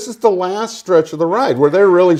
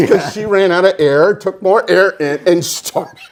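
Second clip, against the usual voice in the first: ordinary delivery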